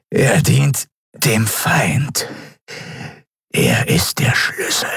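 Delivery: slow, eerie whisper; cryptic whisper. Acted. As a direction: whisper the secret slowly. (geheimnisvolles, langsames Flüstern)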